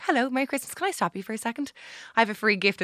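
high, chirpy stop-a-stranger voice; street giveaway pitch. Retold or imitated. Guest re-enacting handing gifts to passers-by, high polite pitch.

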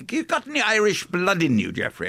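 put-on light Irish accent, lifting up high; a hint of an Irish tramp's lilt; lilting Irish storyteller. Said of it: "he stopped me and he said".

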